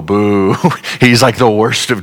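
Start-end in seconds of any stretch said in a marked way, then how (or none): 0.0-0.6 boo. Shown as a demonstration. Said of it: "you should all go".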